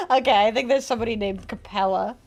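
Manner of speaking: usual speaking voice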